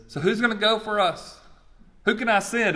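lightly voiced divine call; voice from the throne. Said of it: "the voice from the throne says".

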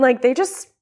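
normal talking voice

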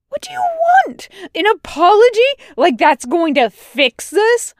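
high, loud, scornful questions; shouting. A narrator's reading. Wash scorns the idea of apologizing to Tucker, shouting. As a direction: throw the questions at him at full voice. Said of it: "Wash shouts".

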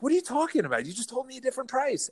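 high mock yell, fast at the end; replaying an angry argument with the seller's partner; retold yelling. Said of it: "I'm yelling at him".

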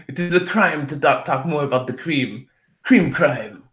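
mock French accent; hammed-up French accent. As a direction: lament it in a put-on French accent. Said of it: "My family's French. I'm allowed to do that".

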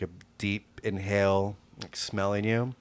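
normal talking voice

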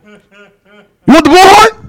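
high, loud cheery offer; eager offer. Retold or imitated. Friends offering Tori the bullhorn, loud cheery tone. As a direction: hold out the bullhorn gleefully.

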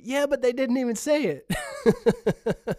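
incredulous retort. Real, not acted. Retorting to imagined excuse.

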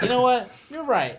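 high, loud goofy yes-man voice; mock eager agreement. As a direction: chirp it up like a pushover.

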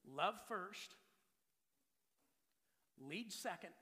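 slow with long pauses between phrases; deliberate dictation pace, each phrase apart; slow, spaced out. Shown as a demonstration. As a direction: spell it out slowly for note-takers. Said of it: "I'm gonna say it slowly".

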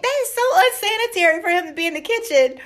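high, fast squeal of mock disgust; mock outrage. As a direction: act scandalized at the shirtless cook. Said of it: "And so I said".

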